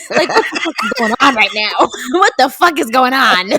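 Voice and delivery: loud, laughing disbelief; mock-panicked outburst through laughter; retold panic. Retelling the stampede to dad, mock panic.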